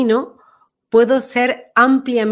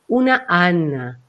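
"Ana" said low and slow, set back; low, drawn-out "Ana" from behind; name placed at the back; 4 semitones lower, slower than usual. Shown as a demonstration. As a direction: let "Ana" sink to the back of the voice. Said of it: "uno puede sentir que está detrás".

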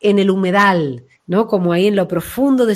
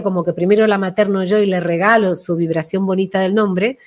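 normal talking voice

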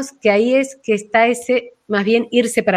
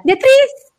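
cold, high Beatriz; 5 dB louder than usual. Shown as a demonstration. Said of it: "que a la vez tenga calor".

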